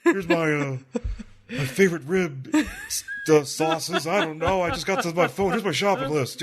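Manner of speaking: lightly voiced rambling Austin. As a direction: stall for time on air. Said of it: "Steve Austin's like".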